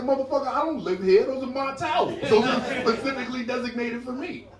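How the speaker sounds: high-pitched indignant protest; indignant guest. Acted. Guest argues with hostess over towels, indignant and high. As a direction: argue back, pitched right up.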